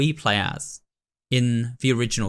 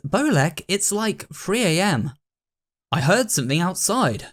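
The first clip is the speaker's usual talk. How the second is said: put-on a lightly higher, hushed girl's voice; Selina's voice; 6 semitones higher than usual. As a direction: voice the nervous leader, slightly lifted.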